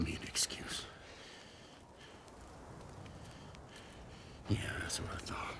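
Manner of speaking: low menacing whisper; threatening whisper. Acted. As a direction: hiss the dare right in his face. Threatening a rival face to face.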